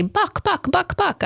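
put-on high, small child's voice; little girl babbling a name; toddler voice. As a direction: say it like a tiny toddler pointing.

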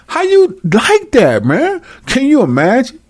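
usual speaking voice

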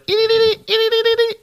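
very high-pitched stuttering squeal; imitated animal noise. Storyteller imitating a rabbit's noise, high squeal. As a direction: squeal in rapid, high little pulses. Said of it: "you hear a noise like".

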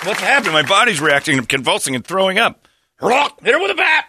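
high, rising mock panic ending in a plea; hammed-up distress; mock convulsing panic. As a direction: act out being sickened by the sound.

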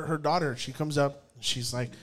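ordinary talk, nothing marked

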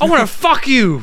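high, hammed-up lusty voice; horny lunatic. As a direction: gush it like a crazed creep. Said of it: "just proceeds like".

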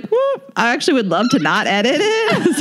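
a high whoop, then a relieved aside; mock alarm. Host retelling dodging the edit job with a mock whoop. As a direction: play the editing dodge as a quick yelp. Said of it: "And I was like".

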